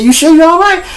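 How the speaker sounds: high-pitched imitation of a doubtful cop; concerned cop. Cop double-checks on the robbed man, high concerned mimicry.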